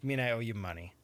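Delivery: ordinary delivery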